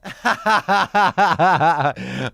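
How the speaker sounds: big real burst of laughter, loud and high. Real, not acted. Host bursting out laughing at his co-host's Death Star story. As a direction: crack up hard at the punchline.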